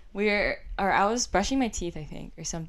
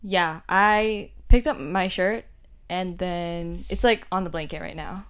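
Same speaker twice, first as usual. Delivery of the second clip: talking super slow; 2 semitones lower, slower than usual. Retold or imitated. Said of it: "You're talking super slow".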